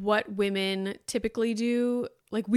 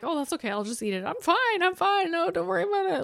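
high, rushed people-pleasing protest; mock anxious-to-please squeak; over-eager reassurance; 8 semitones higher, faster than usual. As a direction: insist brightly that it's fine, pitch leaping up.

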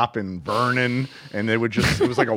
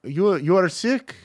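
put-on higher-pitched Persian-accented question; Persian guy; 6 semitones higher than usual. Pager shop worker asking the sick speaker, imitated Persian accent. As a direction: imitate a concerned Persian coworker. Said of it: "one of the guys like".

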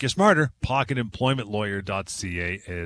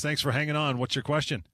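ordinary talk, nothing marked

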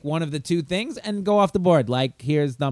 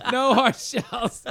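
slow laughing punchline; 8 semitones higher, much slower than usual. Real, not acted.

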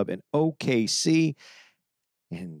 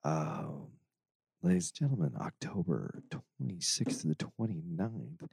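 low, hushed late-night jazz DJ; jazz radio voice; 4 semitones lower, 10 dB quieter than usual. Pretend. Host plugging tour dates to listeners, jazz radio voice. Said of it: "I can do a jazz radio voice".

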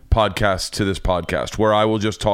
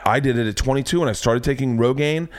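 ordinary talk

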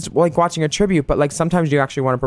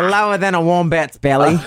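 hammed-up Australian accent in an odd timbre; horrible Australian accent. Cohost answers the insult in a put-on bad Australian accent. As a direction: fire back in a bad Aussie accent. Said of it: "our horrible Australian accent".

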